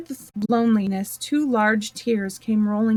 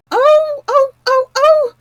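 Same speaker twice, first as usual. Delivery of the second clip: piercing high shrieks, much louder; frantic screaming over an unseen wound; shrieking queen; 10 dB louder, slower than usual. Acted. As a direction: shriek the ohs like a steam whistle. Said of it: "the Queen began screaming so loud".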